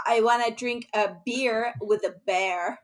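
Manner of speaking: put-on slow, drawn-out Valley Girl American accent; American accent like an eighties TV Valley Girl. Shown as a demonstration. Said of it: "say this for me in an American accent".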